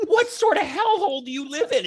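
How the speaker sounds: high-pitched, comically appalled question; appalled disbelief. Retold or imitated. Passenger reacts to the bus driver's alligator story, appalled. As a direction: ask it way up high, incredulous. Retelling his horrified reply to driver.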